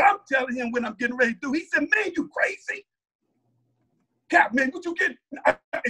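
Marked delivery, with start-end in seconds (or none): 1.9-5.2 high-pitched, mock disbelief; incredulous reaction. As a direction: act out a stunned friend's protest. Brother reacts to the plan.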